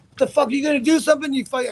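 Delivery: high-pitched retold outburst; frantic appeal. Fighter begs heavyweight bystander to step in, high frantic appeal. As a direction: re-enact urgent pleading, pitch jumping way up.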